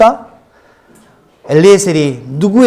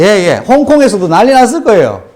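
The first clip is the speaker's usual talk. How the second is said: ordinary talk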